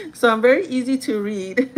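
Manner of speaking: loud, laughing admission; real laughter breaking around the words; amused. Real, not acted. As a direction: laugh at yourself while saying it. Laughing at being read so easily.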